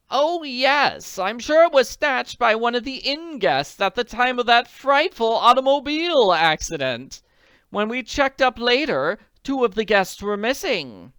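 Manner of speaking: worried wealthy woman; anxious society lady. Acted. Willoughby voice.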